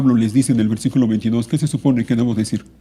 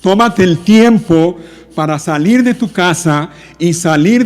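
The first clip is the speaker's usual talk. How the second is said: raised, slow, emphatic exhortation; loud pulpit voice with stretched stresses; forceful preaching; 5 semitones higher, 5 dB louder, slower than usual. Real, not acted. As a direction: drive each phrase home to the congregation.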